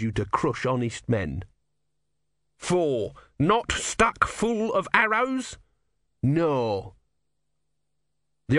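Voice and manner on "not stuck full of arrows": mock biblical prophet. God offers another miracle.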